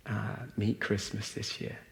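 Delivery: a soft, breathy whisper of the question. Real, not acted. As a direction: lean in and whisper it to the room. Rhetorical question to the audience.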